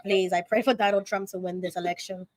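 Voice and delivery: hushed earnest prayer. Real, not acted. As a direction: pray quietly, sign of the cross. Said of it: "No, I'm being for real".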